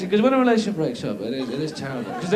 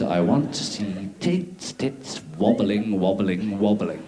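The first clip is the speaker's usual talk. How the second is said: slow leering drawl; 11 semitones lower, 4 dB quieter, slower than usual. Acted. Comic leering fantasy, slowed.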